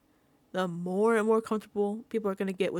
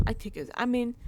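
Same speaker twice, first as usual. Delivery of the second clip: ordinary talk, nothing marked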